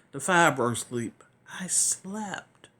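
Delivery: hushed, intimate whisper; whispered aside. Real, not acted. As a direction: drop to a whisper. Hushed aside about sleep.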